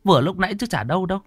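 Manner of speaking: high-pitched, anxious wife voice; fretful wife. Acted. Wife pestering her trembling husband, high and anxious. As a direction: press him with a high, worried nag. Wife tells of mother's visit.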